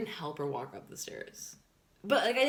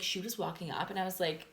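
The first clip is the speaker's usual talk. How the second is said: ordinary talk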